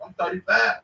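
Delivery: ordinary talk, nothing marked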